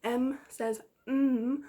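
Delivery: "mm" hummed long and slow; letter sound. Shown as a demonstration. Teacher to pupils sounding out M, slow hum. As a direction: hum the "mm" slowly for the class. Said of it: "the letter M".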